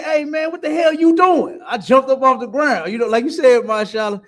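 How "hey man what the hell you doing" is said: high, loud outraged call-out; angry protest. Retold or imitated. The narrator confronting a cop who planted dope, high and outraged. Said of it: "I said".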